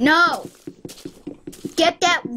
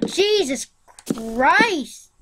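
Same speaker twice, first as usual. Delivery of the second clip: usual speaking voice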